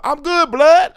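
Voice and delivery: loud, high heckler shout; yelled out. Retold or imitated. Heckler yelling back at the comic, loud. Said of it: "Someone yelled out".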